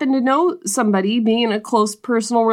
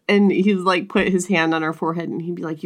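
normal talking voice

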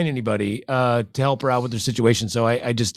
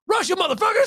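mock war cry. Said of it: "turn to all their friends and be like".

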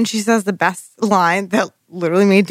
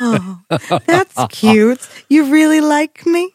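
patronizing; 6 semitones higher, slower than usual. Retold or imitated. Mocking patronizing partner.

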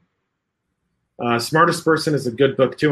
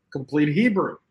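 ordinary talk, nothing marked

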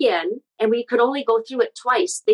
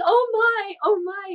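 flustered oh my; 11 semitones higher, slower than usual. Retold or imitated. Her reaction to mom's outburst.

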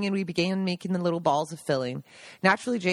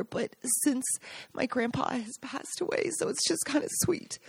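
softer, higher, close to tears; quietly moved remembering grandpa; getting emotional; 5 semitones higher, 5 dB quieter than usual. Real, not acted. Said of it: "I'm getting choked up".

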